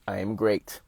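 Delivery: low, flat, mechanical delivery like a robot; robotic. Acted. Motivational speaker mocking an unfeeling reaction, robotic voice. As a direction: say it as an unfeeling machine. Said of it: "robotic now".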